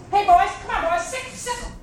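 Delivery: high, loud frightened call; frightened call to the dogs. A narrator's reading. Scared old man calling his hounds, loud and high. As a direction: yell for the dogs in a panic. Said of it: "the old man was so frightened".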